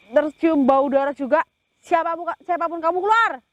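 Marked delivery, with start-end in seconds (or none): 1.8-3.4 rising to a fierce high yell; commanding shout. Acted. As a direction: demand it come out, shouting. Commanding the hidden spirit out.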